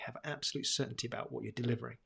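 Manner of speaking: usual speaking voice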